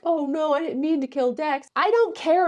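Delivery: high, mock-innocent whine of regret; mock remorse. Champions faking regret over Dex, mock-innocent high whine. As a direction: parody their fake sorry. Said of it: "And they're all like".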